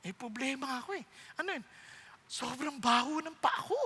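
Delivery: quiet confiding. Acted. Groom confides to mother.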